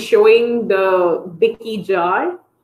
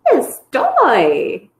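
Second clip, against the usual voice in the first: "toy" given more weight than "Yes"; modeling intonation; 2 semitones higher than usual. Shown as a demonstration. Therapist modeling the word for a child, stress on "toy". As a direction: stretch "toy" out in a sing-song model. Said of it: "if you see the intonation".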